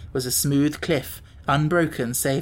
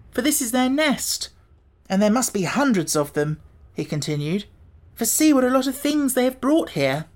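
put-on Tip's boy voice; 8 semitones higher than usual. A narrator's reading. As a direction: answer as the boy Tip. Tip continues.

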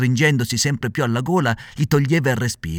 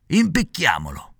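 slow, higher gleeful proposal; first assassin's voice; 4 semitones higher than usual. A narrator's reading. Assassin proposing to hang the puppet, slow and higher. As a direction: savor the word Impicchiamolo slowly. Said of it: "ripeté l'altro".

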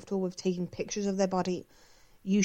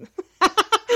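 quick squeal of a laugh; short high laugh; 8 dB louder than usual. Real, not acted. Laughs at own fumble.

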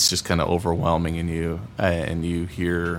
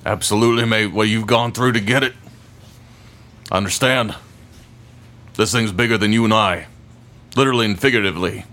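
higher, louder burly smith; gruff blacksmith voice; 4 semitones higher, 5 dB louder than usual. Acted. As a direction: boom it out like a proud smith. Smith answering the player.

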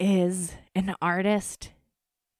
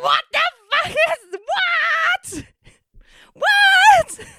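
rising, shouted high-pitched what; shocked disbelief; 10 dB louder, much slower than usual. Retold or imitated. Reading her name among celebrity credits, shrieking in disbelief. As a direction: shriek louder with each what.

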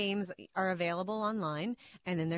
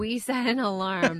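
louder, laughing admission; amused confession, breaking into a laugh; 2 semitones higher, 6 dB louder than usual. Real, not acted. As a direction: laugh through the words. Teased as a tough mom.

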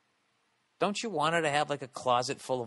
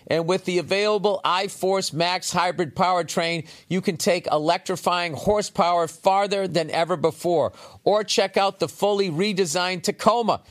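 punchy, louder commercial voice selling trucks; energetic ad read; 8 semitones higher, 10 dB louder than usual. Acted. Host reading a Toyota truck sponsor spot to listeners, upbeat louder ad read. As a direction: sell the Tundra like a radio spot.

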